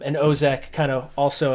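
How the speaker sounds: ordinary talk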